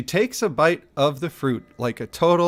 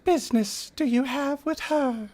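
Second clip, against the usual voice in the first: mystical creature voice; 12 semitones higher, 4 dB quieter than usual. Acted. The Naga answers.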